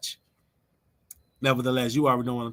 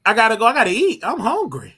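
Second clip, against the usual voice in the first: high hurried sign-off; 7 semitones higher, 6 dB louder than usual. Real, not acted. Rushing off to eat.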